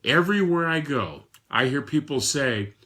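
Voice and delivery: usual speaking voice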